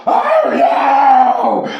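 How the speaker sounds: high, loud panicked scream. A narrator's reading. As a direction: shriek it in horror at the sight. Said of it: "screamed Fred".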